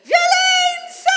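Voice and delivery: very high, loud, drawn-out cry; a slow high sung shout. Shown as a demonstration. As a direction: wail the word Violenza. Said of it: "sta dicendo".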